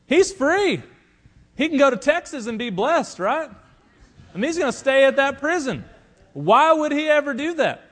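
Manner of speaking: incredulous. Acted. Incredulous preacher on foolish prisoner.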